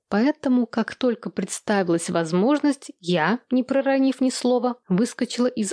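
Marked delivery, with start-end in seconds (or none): none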